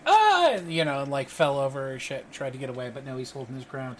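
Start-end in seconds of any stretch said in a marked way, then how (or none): 0.1-0.5 high, loud yell; startled cry. Acted. Game master voicing a guard reeling back, startled cry. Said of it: "I wanted to see if he was like".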